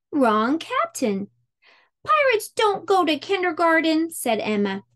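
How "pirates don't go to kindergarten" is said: put-on defiant girl. A narrator's reading. Emma protests, high voice.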